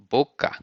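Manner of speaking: Boca as BO-ka, clean vowels; Portuguese boca. Shown as a demonstration. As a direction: model word Boca. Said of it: "you say boca".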